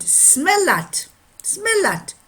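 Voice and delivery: put-on high, loud French-accented command. Watchmaker confronting the complaining customers, French accent. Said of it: "And he said".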